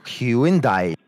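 Hyundai as HYU-in-DYE, drawn out in three parts. Shown as a demonstration. Caller plays his version to the helper, Hyundai stretched to hyu-in-dye. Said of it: "we've been saying".

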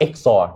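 Exor said as "ek-sor"; name pronunciation. Shown as a demonstration. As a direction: say Exor in two syllables, "ek" then "sor". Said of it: "วิธีอ่านชื่อนะ มัน เอ็กซ์โออาร์".

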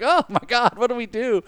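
high-pitched imitated panic; mock panic. Retold panic of movie characters.

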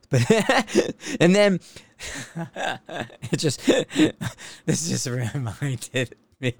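talking through bursts of giggles; broken up by laughter; real laughing. Real, not acted. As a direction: crack up while trying to get the words out. Breaks up laughing at his memory.

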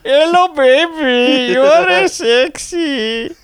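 put-on high, slow Indian accent; mock come-on. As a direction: hit on her in a hammed-up Indian accent. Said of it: "recordings of him hitting on women".

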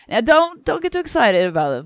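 a lightly played stern teacher with a high loud start; voicing Strange. Host voicing Strange warning his pupil, mild put-on mentor voice.

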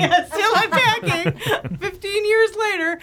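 laughing hard, pitch up high; cracking up through the words. Real, not acted. Laughing at her own joke to the panel.

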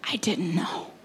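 hushed, broken whisper of apology; whispered repentance. Retold or imitated. Janice whispering her apology to Jesus, hushed and contrite. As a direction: breathe the apology out with no voice. Retold tearful repentant prayer.

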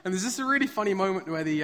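ordinary delivery

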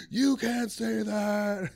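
mock heckler's drawn-out shout, pitched high; outraged heckler. Woman in audience objects to a joke, outraged shout. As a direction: stretch it into an indignant high yell.

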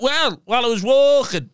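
high-pitched storytelling mum; mum's voice. Retold or imitated. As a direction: mimic mum telling her news. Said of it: "She went".

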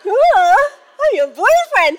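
high, loud, thrilled husband impression; mock perky husband. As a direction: imitate him suddenly perking up with glee. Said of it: "suddenly got all perky".

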